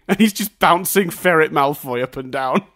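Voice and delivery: usual speaking voice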